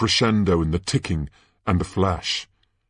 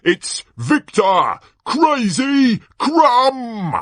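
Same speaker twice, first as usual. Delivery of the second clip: high, loud, drawn-out champion introduction; over-the-top tournament announcer; 8 dB louder, much slower than usual. Acted. Announcer hyping the broomstick champion to the crowd, bellowing excitedly. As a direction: build to a huge shouted name.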